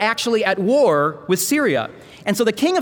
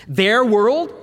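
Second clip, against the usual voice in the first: questioning echo; 3 semitones higher, 3 dB louder than usual. Shown as a demonstration. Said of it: "deal with the topic angels and their world".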